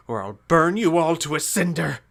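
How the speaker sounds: high, loud burst of rage; enraged trickster god; furious threat. Acted.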